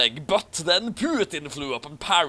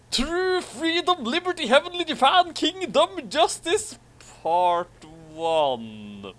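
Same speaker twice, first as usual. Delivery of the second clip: mock-epic announcer; grand title reading; 11 semitones higher than usual. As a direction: proclaim it like an epic banner.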